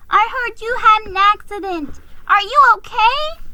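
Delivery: put-on high, loud worried young voice; anxious little nephew checking on his uncle. A narrator's reading.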